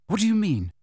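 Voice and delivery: prompt, anxious-to-know query; quickly. A narrator's reading. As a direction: jump straight in with the question. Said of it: "said Harry quickly".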